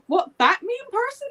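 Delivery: high, incredulous squeak; shocked disbelief. Retold or imitated. Max hearing the sister is the mean boss, high shock.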